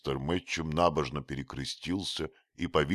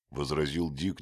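ordinary delivery, nothing marked

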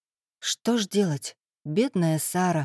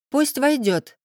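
muffled from another room; 6 semitones higher, slower than usual. A narrator's reading. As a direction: answer from behind a closed door. Voice from behind door.

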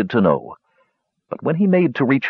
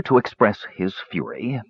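usual speaking voice

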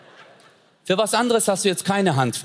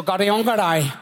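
gibberish Hindi-film line, hammed-up; mock Indian soap dialogue; 5 semitones higher than usual. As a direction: parody a soap husband catching his wife. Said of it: "der so".

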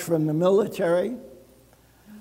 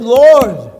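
calling out to God; 9 dB louder than usual. Acted. Praying over the empty fridge.

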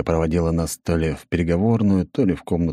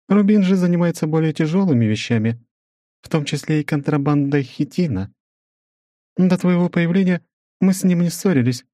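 measured, higher female voice; woman's voice; 9 semitones higher than usual. Acted. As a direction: narrator voicing a businesswoman, higher. Svetlana voiced.

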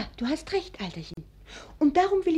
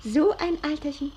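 normal talking voice

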